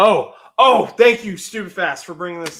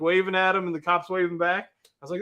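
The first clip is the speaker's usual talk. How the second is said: ordinary talk, nothing marked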